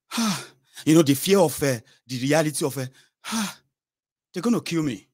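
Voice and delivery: breathless alarm, acted out; panicky victim. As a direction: relay a hostage's rising fear. Victim's terror retold.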